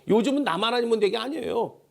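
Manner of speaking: normal talking voice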